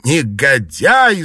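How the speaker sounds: loud, drawn-out rebuke; angry shout. A narrator's reading. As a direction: cry it out slowly in outrage. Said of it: "закричали госпожи Син и Ван".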